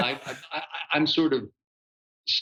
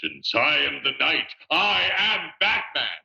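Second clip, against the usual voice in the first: retelling the famous line in a loosely held Batman voice; demoing the character voice for subway fans, lightly done; 4 semitones higher than usual. Retold or imitated. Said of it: "would you do the voice?".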